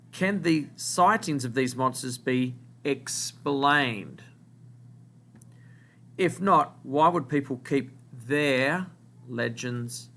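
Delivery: slow, phrase by phrase, with long pauses; slow dictation. Shown as a demonstration. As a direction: read it out slowly so the class can write. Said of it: "word that you need to write down".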